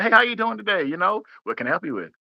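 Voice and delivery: friendly greeting. Shown as a demonstration. Salesman welcoming a customer at the store, smiling. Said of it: "you gotta smile and stuff like that".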